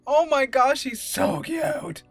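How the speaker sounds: usual speaking voice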